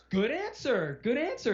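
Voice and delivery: high-pitched mock encouragement; game show cheering. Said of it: "they would be like".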